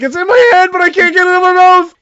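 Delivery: very high, loud, fast panic; panicked screech. Acted. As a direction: shriek it in desperation while falling. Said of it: "he's like".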